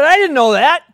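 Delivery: high, loud, wide-eyed reply; eager surprise. Acted. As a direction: blurt it out in innocent wonder. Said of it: "He said".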